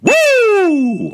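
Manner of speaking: long, loud high whoop; sustained high-pitched cheer; big woo. Real, not acted. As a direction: let out a big celebratory woo. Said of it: "give that whole segment a big ol' woo".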